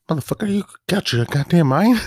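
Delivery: high, loud annoyed movie-hero outburst. Retold or imitated. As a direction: imitate an exasperated hero yelling over gunfire. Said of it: "He's, like, annoyed".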